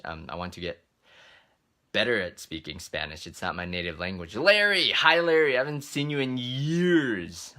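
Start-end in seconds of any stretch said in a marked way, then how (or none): 4.3-7.5 high, loud delighted call-out, last word drawn out; excited greeting. Real, not acted. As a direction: greet a long-lost friend, high and stretched. Greeting a familiar viewer joining.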